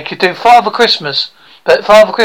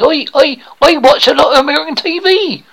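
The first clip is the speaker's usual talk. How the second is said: broad rural British bumpkin, higher voice; eager would-be actor; 7 semitones higher than usual. Acted. Aspiring actor boasts to coach about school play, eager bumpkin voice. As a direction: play a naive hopeful with a thick country accent.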